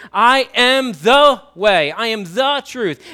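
slow, loud declaration stretching "the"; "the" drawn out and hit hard; emphatic "the". Shown as a demonstration. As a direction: proclaim it, punching each "the". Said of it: "I am emphatically saying".

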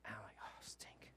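barely audible mock dismay; under-the-breath imitation of worry; whispered dismay. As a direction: whisper it to yourself in retold dread. Retold private dismay.

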